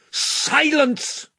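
high, forceful angry command; thundering. A narrator's reading. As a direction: silence the mouse with a roar. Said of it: "thundered Caspian".

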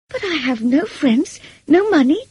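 high, climbing list of objections; disbelieving protest. A narrator's reading. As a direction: protest as if it cannot be true. Jane protests, hardly believing the proposal.

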